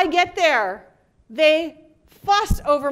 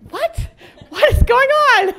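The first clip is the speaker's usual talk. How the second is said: much higher, then a loud startled burst; stunned surprise; 10 semitones higher, 8 dB louder, slower than usual. Retold or imitated. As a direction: yelp it out, caught totally off guard. Surprised by airline gift.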